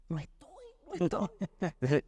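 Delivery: soft, slow hushed "no estoy"; hushed father. Retold or imitated. Father hiding from phone call.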